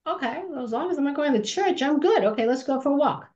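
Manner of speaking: high, quick, cocky "I'm good"; cheeky teen agreeing. Retold or imitated. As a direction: shrug it off, pleased to skip church. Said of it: "I'm like".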